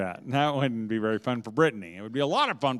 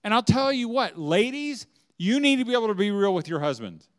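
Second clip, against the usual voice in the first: ordinary delivery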